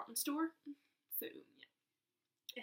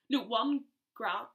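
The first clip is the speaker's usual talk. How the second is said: ordinary talk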